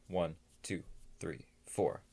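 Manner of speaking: soft count, trailing off. Shown as a demonstration. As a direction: count quietly under the breath. Counting rapid breath cycle.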